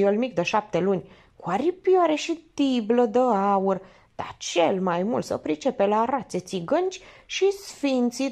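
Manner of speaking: high, animated gossip, stretching the details; excited woman rattling on in a high voice; carried away. A narrator's reading. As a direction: get worked up telling it, pitched well up. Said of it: "Femeia se ambalase".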